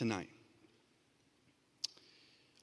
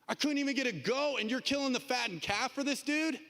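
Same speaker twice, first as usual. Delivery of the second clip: indignant, pitched well up; can't believe it, bristling; outraged older son; 7 semitones higher than usual. Retold or imitated. Older son protesting to his father, indignant and high.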